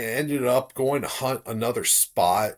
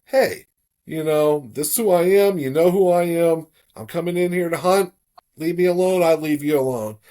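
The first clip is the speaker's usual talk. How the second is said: raised, called-out announcement, pitched up and louder; calling out into the woods; 6 semitones higher, 5 dB louder than usual. Retold or imitated. Hunter announcing himself to unseen creatures in the woods, loud calling voice. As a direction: call it out so the whole forest hears.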